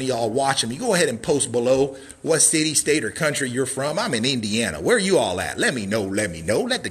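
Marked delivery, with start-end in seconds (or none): none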